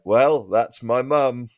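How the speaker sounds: ordinary delivery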